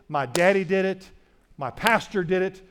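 ordinary talk

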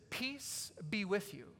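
soft, slow, gentle peace; gracious greeting. Retold or imitated. Jesus greeting doubting Thomas, soft and gracious. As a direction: greet him tenderly. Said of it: "He's gracious to Thomas".

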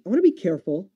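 normal talking voice

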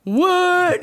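high, loud, gaping disbelief; re-enacted slack-jawed astonishment; open-mouthed shock. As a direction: blurt it with your jaw dropped. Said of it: "with my mouth open".

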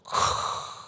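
breathy burst of mouth-made thunder; rough voiceless rumble noise; imitated thunder. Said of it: "this thunder".

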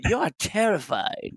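high, lilting imitation British accent; soft British accent. Imitating a friend's first-meeting remark in a soft British accent. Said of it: "softens with that accent. It sounded very soft".

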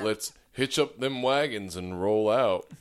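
slow, hammed-up Texas cowboy drawl; rally the wagon train, slowly, in Texan; Texas accent. Trading cowboy lines, slow Texas drawl. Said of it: "Can you do a Texas accent?".

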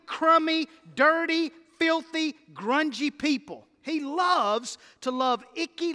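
hammering each adjective to the church; full-force preaching. Real, not acted. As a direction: build the list like a revival preacher.

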